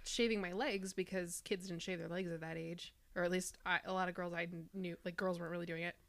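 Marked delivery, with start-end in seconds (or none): none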